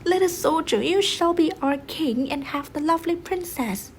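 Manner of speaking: high, loud cheering call; crowd shout. Acted. The crowd shouting to the soldier, proclaiming him king. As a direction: hail him as the new king. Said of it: "all the people shouted".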